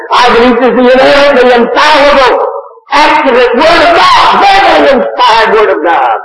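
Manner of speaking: preacher thundering at the congregation; preaching at full force. Acted. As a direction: build it up to a proclamation. Preaching climax.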